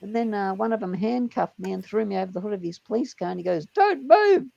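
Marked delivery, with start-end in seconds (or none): 3.7-4.5 high, loud retold command; mock cop bark. Cop pins handcuffed man on bonnet, high mock bark. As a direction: bark it like an arresting officer.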